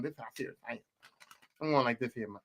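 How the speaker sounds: low, soft pretend boss running a meeting; hushed, low mock-ominous authority; serious boss. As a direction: drop down quiet and deadly serious.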